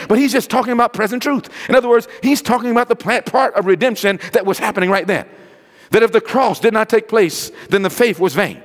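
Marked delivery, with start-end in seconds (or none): none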